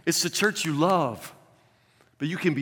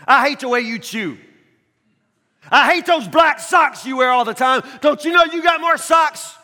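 mock nagging shout; 11 semitones higher, 11 dB louder than usual.

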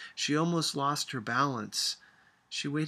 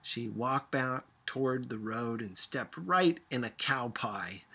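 ordinary delivery, nothing marked

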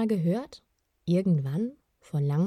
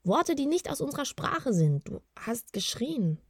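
higher, hesitant voice, slowing and softening at the end; cautious; 7 semitones higher than usual. A narrator's reading. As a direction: break it to him carefully. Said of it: "sagte Gurgel vorsichtig".

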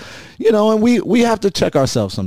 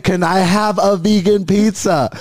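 higher, slow mock yell into the phone; retold yell; 9 semitones higher than usual. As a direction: roep het traag en hoog de telefoon in. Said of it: "And then I yelled at her".

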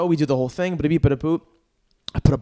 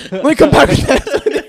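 laughing mimic of dealer; 12 semitones higher, 11 dB louder, slower than usual. Dealer retreats, teller laughing.

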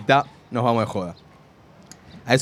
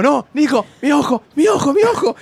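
high, loud panicked shouting; panicked cry; 11 semitones higher, 4 dB louder than usual. Retold or imitated. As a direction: re-enact frantic alarm to a friend. Acts out his panic to friend.

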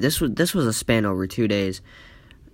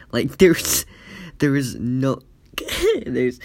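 words broken by real laughter; can't get it out for laughing; laughing through words; slower than usual. Real, not acted. Describing A J's blank face, laughing through the words.

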